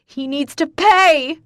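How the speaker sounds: slow, loud, high screech; shrill vengeful scream. Acted. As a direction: screech for revenge. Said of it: "I screech".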